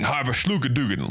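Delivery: hammed-up German-sounding nonsense; mock German. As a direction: parody how outsiders think German sounds. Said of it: "Oh, Germans talking".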